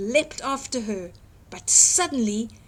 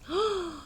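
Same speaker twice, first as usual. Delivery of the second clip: delighted gasp; 8 semitones higher, 3 dB quieter than usual. Acted. Delighted at the cakes.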